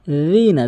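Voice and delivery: "রিনা" begun with soft dh, as in 'this'; correct soft dhal. Shown as a demonstration. Teacher showing the dhal, soft dental pronunciation. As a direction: put tongue tip to teeth for "রিনা". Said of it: "জিহ্বার আগে দাঁতের আগে".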